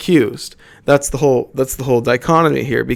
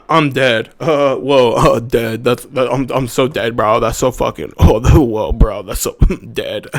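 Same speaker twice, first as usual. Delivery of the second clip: lightly voiced teen, giggly and smug; mock TikTok kid. Mocking TikTok kids, a light put-on giggly voice. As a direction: play the kid who says he's dead from laughing. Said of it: "They say".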